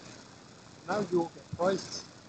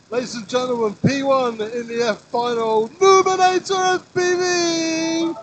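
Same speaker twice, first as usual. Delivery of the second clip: much higher and louder, stretched; hype announcer; 7 semitones higher, 8 dB louder than usual. Acted. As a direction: shout the winner's name out big. Announcing the winner to crowd.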